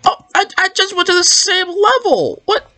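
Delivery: very high-pitched, squeaky disbelief; high, stammering whine of dismay; dismayed realisation. Real, not acted. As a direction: squeal it as the mistake sinks in. Realises a mistake in game.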